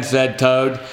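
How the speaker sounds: usual speaking voice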